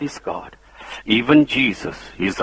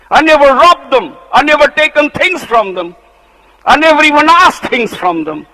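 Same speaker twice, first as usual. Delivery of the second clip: high, loud aggrieved plea; indignant Moses; 14 semitones higher, 8 dB louder than usual. Acted. Moses begs God to reject the rebels' offering, indignant. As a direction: protest your innocence to God. Moses pleading his innocence.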